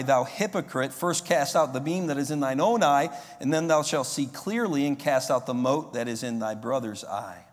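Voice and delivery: loud, ringing scripture reading; preaching scripture. Acted. Preacher booming scripture to his congregation. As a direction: proclaim the verses to the whole hall. Reads scripture at full force.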